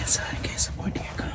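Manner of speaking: hushed whisper in the blind. Real, not acted. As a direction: whisper so deer don't hear.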